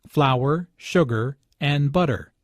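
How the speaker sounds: "Flour", "sugar", "and butter" each stressed with a short pause between; measured list, falling on "butter". Shown as a demonstration. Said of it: "short pauses between each item".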